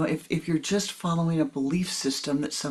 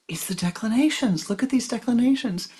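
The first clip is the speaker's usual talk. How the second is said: higher, brighter joyful pointing-out; joyful teacher; 7 semitones higher, 5 dB louder than usual. Retold or imitated. Guru showing student the chart, light mock joy. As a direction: share the discovery with joy. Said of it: "the joy in his voice".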